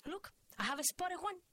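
high-pitched excited exclamation; excited Cuban mother. A narrator's reading. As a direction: spot it and cry out eagerly. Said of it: "She was pointing excitedly".

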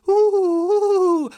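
high, loud fluttery coo; dove cooing. Acted. Storyteller voicing excited barnyard doves, high cooing.

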